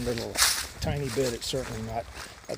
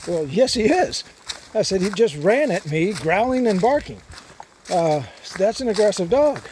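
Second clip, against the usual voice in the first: retold heated argument; 6 semitones higher, 6 dB louder than usual. Arguing with the dog owner.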